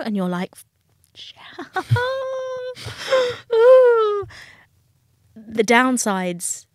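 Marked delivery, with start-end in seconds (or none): 1.2-4.4 a high, loud burst of laughter; laugh-yell, high and loud; disbelieving laughing shriek. Retold or imitated. Bailiff recognises her at door.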